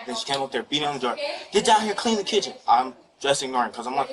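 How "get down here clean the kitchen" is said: higher, mock yell of a nagging mother; a light imitation of mom shouting orders; imitating his mother. Teen son imitating his mother to the officer, mild mock yell. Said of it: "she's yelling her name".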